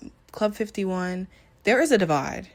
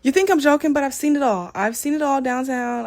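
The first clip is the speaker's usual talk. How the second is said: loud, higher-pitched insisting; emphatic insistence; 6 semitones higher, 6 dB louder than usual. Real, not acted. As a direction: insist with rising volume, then ease off. Insisting she has seen it all.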